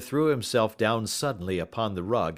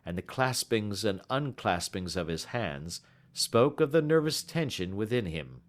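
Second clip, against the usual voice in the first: ordinary delivery, nothing marked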